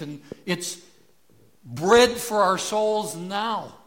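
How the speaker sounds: full-force sermon delivery with weighty pauses; impassioned preaching. Real, not acted.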